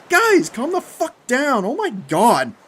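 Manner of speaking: high, slow, drawn-out shout. A narrator's reading. Jeff to his thrashing friends, shouting. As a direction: yell at panicking friends to calm down. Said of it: "Jeff shouted".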